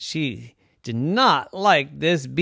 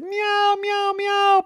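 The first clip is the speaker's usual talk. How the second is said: very high meowing; scared meow; 4 dB louder, much slower than usual. Acted. Cat frightened by the dog, very high meows. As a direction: mew like a frightened kitten. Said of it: "And the cat said".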